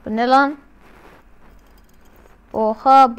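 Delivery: usual speaking voice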